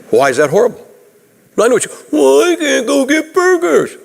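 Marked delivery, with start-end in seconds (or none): 1.6-4.0 high, put-on complaining voice; mock whine about burger money; whiny objector. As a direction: pitch it up like a whining kid. Imagined complaint from listener.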